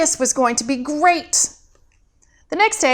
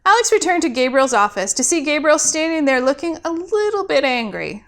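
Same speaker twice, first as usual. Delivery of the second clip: usual speaking voice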